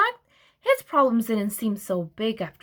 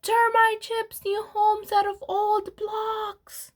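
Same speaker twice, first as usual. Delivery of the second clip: slow, higher newsreader headline voice; headline announcer; 10 semitones higher, slower than usual. A narrator's reading. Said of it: "headlines were screaming the news".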